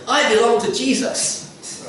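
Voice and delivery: high, slow, smug mock piety; mocking quote. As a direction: parody a self-righteous believer, drawn out. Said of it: "Some will say".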